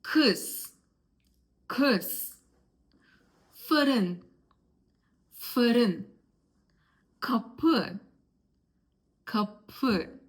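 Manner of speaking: ı in kız, fırın, kapı as an unrounded back vowel, like uh; each word alone, falling, with pauses to repeat; demonstrated ı sound. Shown as a demonstration. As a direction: model the dotless ı clearly for learners. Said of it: "let's start with words that have ı".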